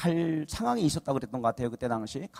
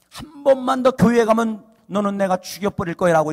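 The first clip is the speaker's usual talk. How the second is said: loud retold threat, higher pitch; a raised-voice threat, retold; threatening; 6 semitones higher, 12 dB louder than usual. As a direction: imitate a menacing husband. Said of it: "이렇게 협박을 하더래요".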